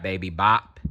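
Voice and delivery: usual speaking voice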